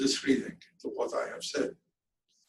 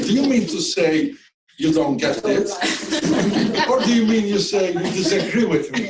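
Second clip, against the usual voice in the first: louder mock challenge breaking into a laugh; 3 semitones higher, 6 dB louder than usual. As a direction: press the question, then laugh through it. Mock challenge to British colleague.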